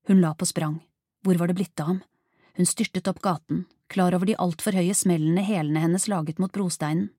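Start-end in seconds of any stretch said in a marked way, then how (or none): none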